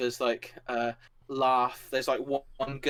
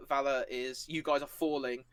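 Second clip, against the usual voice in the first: usual speaking voice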